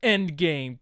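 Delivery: ordinary delivery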